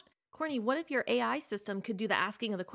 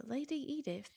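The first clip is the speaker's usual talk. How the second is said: soft, higher posh British; hushed Downton-style British, slightly off; posh British accent; 5 semitones higher, 8 dB quieter, slower than usual. Shown as a demonstration. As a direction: attempt an aristocratic British accent, quietly. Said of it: "like Downton Abbey".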